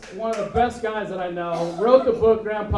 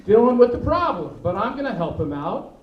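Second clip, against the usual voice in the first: ordinary delivery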